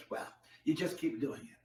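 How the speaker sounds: ordinary talk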